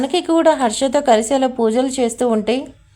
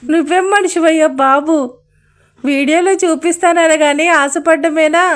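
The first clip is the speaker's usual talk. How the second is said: exasperated scolding; 4 semitones higher, 3 dB louder than usual. Acted.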